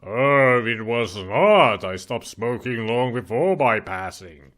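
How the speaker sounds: slow, eerie ghost voice; drawn-out voice from the grave; dusty mummy rasp. Acted. Ghost answers about smoking.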